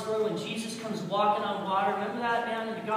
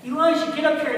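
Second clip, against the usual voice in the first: loud, higher-pitched call; commanding summons; 7 semitones higher, 9 dB louder than usual. Acted. God summoning Elijah to the cave, commanding summons. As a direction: voice God calling out an order.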